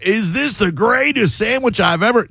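high-pitched, over-the-top gushing; putting on airs. Shown as a demonstration. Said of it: "to put on any airs".

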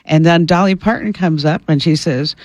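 ordinary talk, nothing marked

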